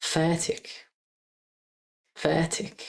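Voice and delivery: "Fertig" with a soft, barely voiced R; "Fertig" said with a gentle, soft R; soft R practice. Shown as a demonstration. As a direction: soften the R in "Fertig". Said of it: "like a soft R".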